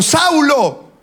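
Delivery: high-pitched call of a name. Acted. God appearing to Saul, calling out.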